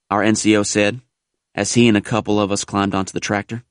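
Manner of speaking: usual speaking voice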